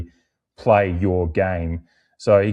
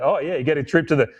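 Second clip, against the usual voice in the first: gleeful mock taunt; 8 semitones higher, faster than usual. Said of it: "the real glee in the Port fan's voice".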